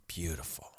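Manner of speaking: soft, low, near-whispered praise; hushed admiration. Real, not acted. Host praising an Italian restaurant, hushed admiration. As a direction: drop to an admiring murmur.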